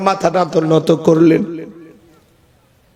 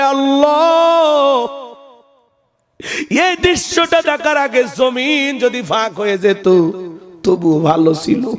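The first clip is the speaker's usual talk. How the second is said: imitated tearful cry; 4 semitones higher, slower than usual. Preacher voicing Ammar's cry to Allah, imitated tearful cry. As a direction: chant his anguish, stretching the first word and falling at the end. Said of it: "কাঁদে আর বলে".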